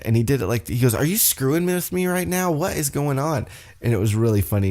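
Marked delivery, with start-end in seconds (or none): 0.9-3.5 baffled AJ. Retold or imitated. AJ baffled by Shinsuke.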